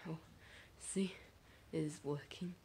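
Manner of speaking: ordinary talk, nothing marked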